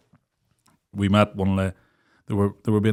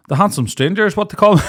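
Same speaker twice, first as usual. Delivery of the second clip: higher, louder mock brag; teasing impression of his friend; mock boast; 9 semitones higher, 4 dB louder than usual. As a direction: ham up the bragging. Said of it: "I did not say that".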